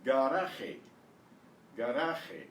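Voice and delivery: "Garaje" as gah-RAH-kheh; exaggerated Spanish pronunciation. Shown as a demonstration. Teacher modelling the drill word "Garaje" in Spanish sounds. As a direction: say "Garaje" with hard G, tapped R, throaty J. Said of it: "trying to exaggerate a little bit".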